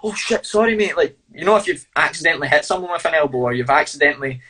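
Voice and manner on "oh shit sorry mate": imitated apology. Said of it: "No, it wasn't like a".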